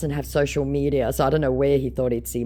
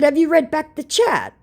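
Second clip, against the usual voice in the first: sharp question; 6 dB louder than usual. Shown as a demonstration. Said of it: "So I showed again".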